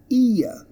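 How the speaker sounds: high, falling "ear", ee sliding to uh; two-sound diphthong. Shown as a demonstration. Teacher models the word, "ear" in two sounds. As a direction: say "ear" as ee-uh, dropping the r. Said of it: "Listen to the two sounds".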